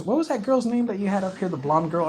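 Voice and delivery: high-pitched prying mother; imitating mom. Mom interrupting her son's massage out of the blue, imitated high and prying. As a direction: put on a mother's high voice for an untimely question. Acting out mom's prying question.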